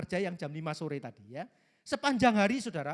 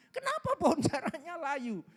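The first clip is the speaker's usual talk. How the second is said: angry complaint; 7 semitones higher than usual. Retold or imitated. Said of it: "dia marah-marah lagi".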